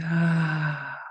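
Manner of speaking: long releasing exhale on an "ah"; breathy, drawn-out sigh of release; audible sigh. Shown as a demonstration. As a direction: sigh it out audibly to let tension go. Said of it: "exhale with an audible sigh".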